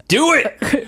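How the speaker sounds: mock eager. Mock call to set off now.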